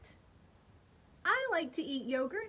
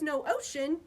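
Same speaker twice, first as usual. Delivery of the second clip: plain reading voice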